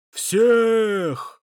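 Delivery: loud, high single-word shout. A narrator's reading. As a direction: roar it back. Crowd shouts back.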